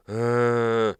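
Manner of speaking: long, loud held "uh"; drawn-out collective groan, imitated; crowd groan. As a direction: imitate a whole plane groaning at once. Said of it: "the whole plane went".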